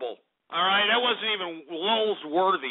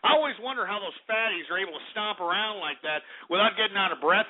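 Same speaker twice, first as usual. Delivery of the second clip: ordinary talk, nothing marked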